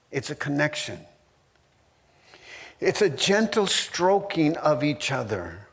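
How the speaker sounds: ordinary delivery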